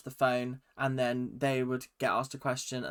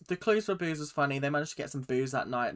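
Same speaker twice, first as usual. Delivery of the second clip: normal talking voice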